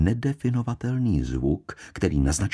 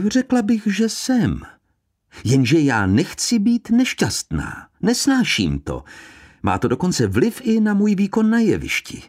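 pouting actress; 8 semitones higher than usual. A narrator's reading. Actress complains to Poirot.

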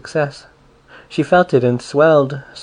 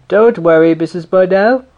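loud, higher hearty neighbour voice; jolly big neighbour; 6 semitones higher, 7 dB louder than usual. A narrator's reading. Big neighbour reassuring the grandmother, loud and hearty. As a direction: boom it out like a big jolly mother.

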